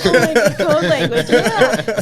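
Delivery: high, loud laughing repeat; delighted laughing agreement. Real, not acted. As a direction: laugh through the words. Laughing at code language.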